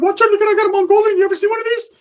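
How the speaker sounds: very high, quick squeal; excitable Ricky impression. Ricky showing the homie his Mongolian, very high squeal. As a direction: show off in a squeaky cartoonish voice. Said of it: "Ricky's like".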